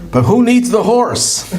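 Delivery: high, slow and drawn out, mock baffled; incredulous punchline. Rich guy asks the driver, high slow mock disbelief. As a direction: stretch it out like the obvious question nobody asked.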